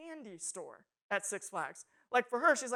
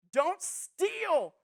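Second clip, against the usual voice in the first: higher, louder emphatic order; proclaimed command; 8 semitones higher, 7 dB louder than usual. Acted. Preacher voicing the Ten Commandments, loud command. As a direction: ring it out as a stern rule.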